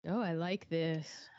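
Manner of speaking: slow, low husky voice. Shown as a demonstration. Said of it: "how I'm supposed to sound".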